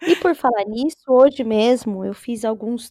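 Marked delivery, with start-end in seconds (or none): 0.0-0.3 laughing. Real, not acted. Laughing at a philosophical question.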